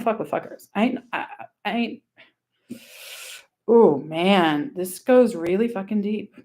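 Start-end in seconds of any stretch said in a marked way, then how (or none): none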